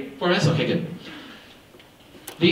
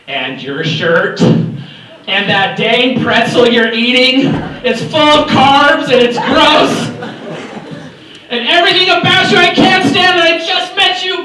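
slow, hammed-up tirade, higher and louder as it builds; mock yelling at a bill; 8 semitones higher, 5 dB louder than usual. Speaker insulting a crumpled twenty-dollar bill for students, mock rant. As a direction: pretend to despise the twenty, dragging each insult out. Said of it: "I screamed at it, I told it it is worthless".

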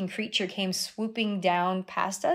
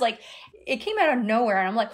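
ordinary talk, nothing marked

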